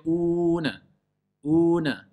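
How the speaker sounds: un as long "ouu-ne" with an echo; faulty qalqala on un; bouncing final N. Shown as a demonstration. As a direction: release the N of un into a short stray vowel. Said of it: "Par exemple, ils disent".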